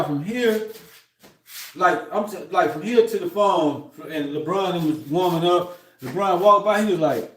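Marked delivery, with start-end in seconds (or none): none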